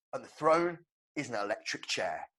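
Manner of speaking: ordinary talk, nothing marked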